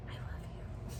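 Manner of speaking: breathy whisper, no voice at all; whispered gratitude. Retold or imitated. Whispering love to a coworker who praised her brows. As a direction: whisper it like a secret thank-you.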